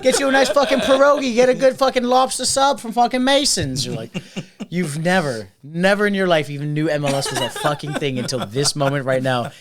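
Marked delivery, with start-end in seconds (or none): 0.0-3.8 loud, much higher Boston know-it-all fan; Jerry. Retold or imitated. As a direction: brag excitedly in a hammed-up Boston accent. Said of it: "Classic Boston".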